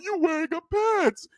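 meek, whiny fake-tearful answer; mock crying. Two crying boys answer the teacher, mock crying. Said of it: "crying in front of the whole class".

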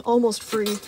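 plain reading voice

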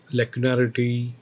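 ordinary delivery

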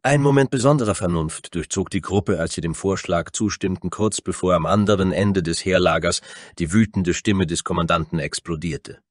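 ordinary delivery, nothing marked

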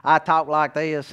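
slow East Tennessee drawl; East Tennessee accent. Shown as a demonstration. Preacher showing his boyhood speech, East Tennessee drawl. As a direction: stretch the vowels like thick East Tennessee talk. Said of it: "showed up in Pennsylvania from East Tennessee".

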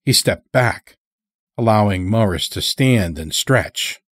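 ordinary delivery, nothing marked

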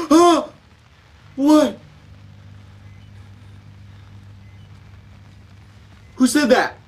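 high, loud yelps of alarm; real jumpy fright; startled. Real, not acted. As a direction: gasp and demand who is there.

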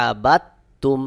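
আবাত তুম with no দ, only a doubled ত; silent dal, doubled ta. Shown as a demonstration. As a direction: close আবাত on a held t, release into তুম. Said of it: "তাসদিদওয়ালা হরফকে উচ্চারণ করে". (আবাত তুম, দাল বাদ দিয়ে তাশদিদসহ)

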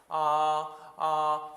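"아" long, high and level, loud; a diligent classroom "아" on a steady high note; textbook first tone. Shown as a demonstration. Imitating learners who do well only in class, high level tone. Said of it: "수업 시간에만 잘해".

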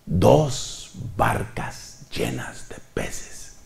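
slow whispered wonder; hushed, slow whisper of awe; awed whisper. Shown as a demonstration. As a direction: breathe it out in amazement.

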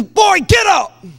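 loud, high scolding father; God as stern father shouting at Joshua; stern daddy God. Acted. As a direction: scold like a fed-up dad. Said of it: "another part that's like a daddy".

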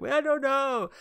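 mock tearful child; hurt little girl. Girl answers counselors.

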